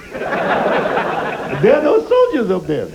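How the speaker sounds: slow, laughing mock officer; lightly voiced officer, laughing; mock general through laughter. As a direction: drag it out while cracking up.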